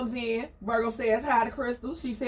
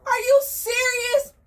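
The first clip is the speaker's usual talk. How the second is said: high, loud shocked squawk; shocked disbelief; 13 semitones higher, 4 dB louder, slower than usual. Retold or imitated. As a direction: gasp it out in disbelief. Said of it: "Crystal's like".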